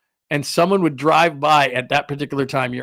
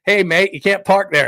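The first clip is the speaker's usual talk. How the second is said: lightly voiced drive-by heckler, louder and higher; passer-by's heckle; 6 semitones higher, 5 dB louder than usual. Somebody driving by a crash, mock call-out. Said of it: "somebody drives by and goes".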